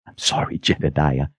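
unvoiced apologetic whisper. A narrator's reading. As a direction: breathe the apology.

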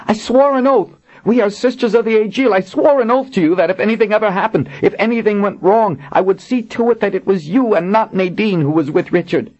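high, urgent woman warrior; a distraught guard pleading high; Kara. Acted. Kara voiced in the story.

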